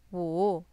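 low dip on wu, then up; falling-rising tone on wu. Shown as a demonstration. Teacher models number five for learners, falling-rising wu. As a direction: dip wu low and let it rise. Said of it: "This is a falling rising tone".

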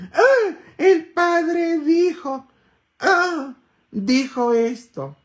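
high, slow, whiny mock voice; mocking gossipers. Priest mimicking people who gossip about what he said, mock whiny. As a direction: sneer it like petty gossip. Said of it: "Y la gente dice".

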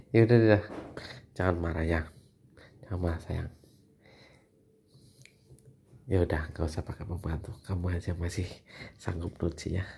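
calm her down gently; soft reassuring apology to wife; soothing. Real, not acted. Husband soothes upset wife.

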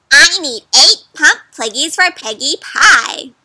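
loud bossy witch voice; demanding witch. Acted. Witch demanding eight piggies from the duck, loud and bossy. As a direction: order the pigs like a greedy witch.

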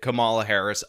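Kamala said kuh-MAH-luh; common mispronunciation. Shown as a demonstration. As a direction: put the stress on Kamala's middle syllable, kuh-MAH-luh. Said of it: "almost everybody pronounced it".

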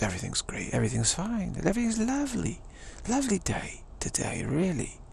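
Cockney lilt climbing high and cheery; softly rising rustic Cockney voice; Cockney countryside accent. Acted. Insisting everything's lovely, in a cheery Cockney countryside accent. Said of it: "Cockney, uh, countryside accent".

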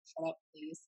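tired, under-the-breath brush-off; a soft, fed-up mutter at a pestering fan; weary mutter. Retold or imitated. Batista staring, annoyed at fan.

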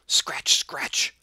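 unvoiced scratching sound. A narrator's reading. Cat scratches at the window, unvoiced. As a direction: rasp it like claws on wood. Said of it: "scuffled Simpkin".

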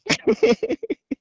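high, short laugh. Real, not acted. Woman recalling her fright to the prankster, high laugh.

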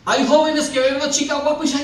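normal talking voice

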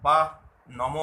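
"বা, নম" said "bah, nomoh"; visarga as h. Shown as a demonstration. Teacher recaps the examples for learners, visarga demo. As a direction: end both "বা" and "নম" on h. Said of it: "বিসর্গের প্রকৃত উচ্চারণ হচ্ছে হয়ের মতো".

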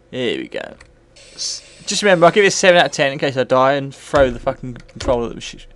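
usual speaking voice

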